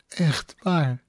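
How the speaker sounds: small high squeak through tears. A narrator's reading. Lily squeaking her apology while crying. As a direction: squeak it out tearfully. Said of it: "piepte ze".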